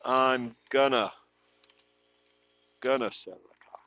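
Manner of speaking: I'm gonna sell the car with gonna as one word; gonna reduction. Shown as a demonstration. Teacher showing the informal spoken version. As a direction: squash going to into gonna. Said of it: "O la otra que lo vas a escuchar es".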